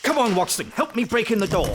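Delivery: loud, high urgent call to break in; urgent shout. Acted. Holmes shouting to Watson to break in the door, urgent and loud. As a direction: yell for help at the door.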